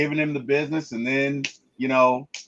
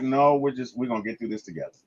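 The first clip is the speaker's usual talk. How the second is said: softer, gentle reassurance; calm her friend down softly; soothing friend; 5 semitones lower, 5 dB quieter, faster than usual. Retold or imitated. Friend soothing a struggling friend, soft and gentle. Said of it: "And she's like".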